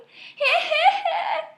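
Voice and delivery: critters' shrieking laugh; high cackling laugh. A narrator's reading. Three critters mocking the gator, high cackling laugh. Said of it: "laugh till they cry".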